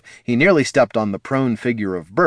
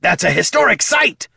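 high, strained yell of protest; aggrieved kobold shouting; indignant shout; 9 semitones higher, 4 dB louder than usual. Acted. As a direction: holler it in outraged complaint. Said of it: "shouted Bert".

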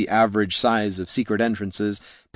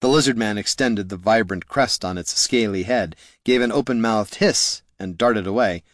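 plain reading voice

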